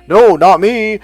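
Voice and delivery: high, loud indignant protest; mock denial. Wealthy client insists to Mike he's no caveman, loud mock protest. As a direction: push back hard, offended.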